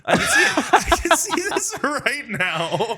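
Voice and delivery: high squeal breaking into laughter; laughing. Real, not acted. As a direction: giggle through the words. Picturing a funny scene, laughing.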